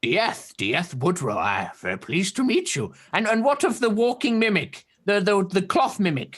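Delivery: put-on high, curious old turtle creature voice; a wary hermit peering at strangers; old turtle man. GM voicing the old turtle hermit to the party.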